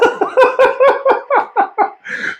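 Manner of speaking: real high, airy laughter fading out; can't stop laughing; breathy laugh. Real, not acted. Keeps laughing at clip.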